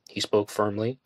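ordinary delivery

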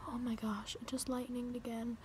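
whispered fright as lightning flashes; frightened whisper. Real, not acted. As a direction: whisper it so the storm won't hear.

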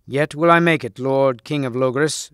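usual speaking voice

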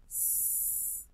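unvoiced hissed "S"; hissing S sound. Shown as a demonstration. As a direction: hiss the "S" like a snake. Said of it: "la S". (sonido de S siseado)

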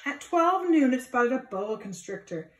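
ordinary delivery, nothing marked

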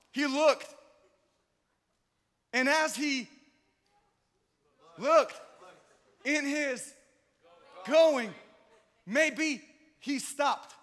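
shouted high and slow, stretched words; exaggerated shouting preacher, slowed way down; mock over-the-top preaching. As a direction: ham up the preacher's build. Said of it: "No, I'm kidding".

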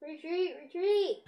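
high urgent call to retreat; frantic retreat order. Pretend. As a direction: shout to fall back in panic. Joker flees in play.

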